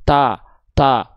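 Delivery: TA with a thick, rounded vowel, close to "to"; thick careless ta. Shown as a demonstration. Teacher showing learners what to avoid, ta thickened toward to. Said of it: "jangan bukan cuman".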